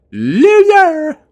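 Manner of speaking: high, loud playful shout of Loser; cartoonish teasing yell; mock yell. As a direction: bellow it as a jokey insult. Mock yelling a word for L.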